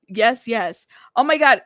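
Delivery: normal talking voice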